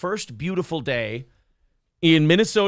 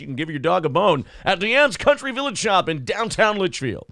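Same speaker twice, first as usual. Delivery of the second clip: fast, nasal local radio ad read; hammy small-town radio commercial; 3 semitones higher than usual. Shown as a demonstration. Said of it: "I didn't go up into my nose and go".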